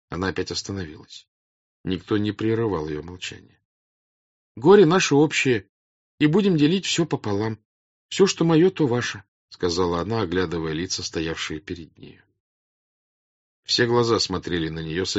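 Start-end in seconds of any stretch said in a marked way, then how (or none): 4.6-9.2 high, loud appeal to a crowd; earnest princess. Acted. Princess offers bread and homes to peasants, earnest appeal. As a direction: plead with them earnestly, voice raised.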